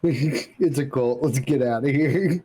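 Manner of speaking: slow, giggling echo of the quote; laughing repeat. Real, not acted. Friend laughing while echoing the punchline. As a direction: laugh through the words.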